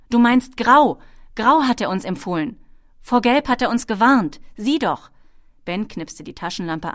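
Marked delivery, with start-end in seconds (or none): 0.1-5.1 loud, higher, emphatic correction; calling out. A narrator's reading. Ben sets the kobold right on the map colours, calling out. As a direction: hammer the correction home at raised volume. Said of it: "rief Ben".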